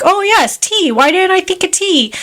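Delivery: high-pitched, hammed-up sudden realization; Mad Hatter. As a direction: play a dotty character struck by an idea. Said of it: "the Mad Hatter's like".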